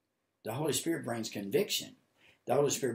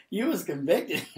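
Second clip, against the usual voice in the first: higher, broken up by laughter; laughing; 6 semitones higher, 3 dB louder, slower than usual. Retold or imitated. Preacher replaying his reply to the Lord, laughing. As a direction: chuckle through the retold plea.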